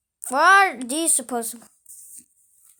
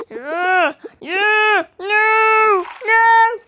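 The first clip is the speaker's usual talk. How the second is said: excited squeals; 9 semitones higher, 7 dB louder, much slower than usual. Real, not acted. Excited at game reward.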